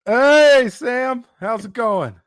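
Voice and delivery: loud, high-pitched hello; big, drawn-out high "hey" easing into the question; excited greeting. Real, not acted. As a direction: call out to a friend who just joined. Calls out greeting to Sam joining.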